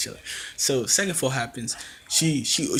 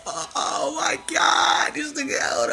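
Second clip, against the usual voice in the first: very high, drawn-out amazed squeal; mock high-pitched freak-out; hammed-up shock; 14 semitones higher, slower than usual. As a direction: replay your stunned reaction in a squeaky high voice. Acting out own shocked reaction.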